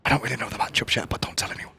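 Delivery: hushed imitation of a secret admission; confiding whisper. Caster retelling what a player privately admitted, confiding whisper.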